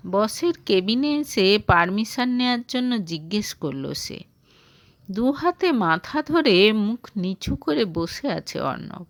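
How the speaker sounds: ordinary delivery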